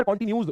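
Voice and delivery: कंटीन्यूअस said as "kon-tin-yooz"; misspelling read aloud. Shown as a demonstration. Teacher teasing class over misspelling, कंटीन्यूअस mispronounced "continyooz". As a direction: end कंटीन्यूअस in "-nyooz", dropping the last syllable. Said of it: "कंटीन्यूअस भी नहीं, न्यूज़".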